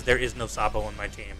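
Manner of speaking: ordinary talk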